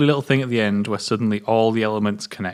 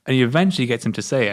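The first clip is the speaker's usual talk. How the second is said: usual speaking voice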